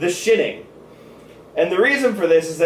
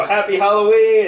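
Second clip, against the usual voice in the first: mock cheery greeting; 7 semitones higher, 6 dB louder than usual. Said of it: "turn and go".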